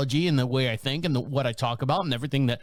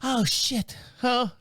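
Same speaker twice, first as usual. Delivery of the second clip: startled; 7 semitones higher, much slower than usual. Retold or imitated. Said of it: "she was like".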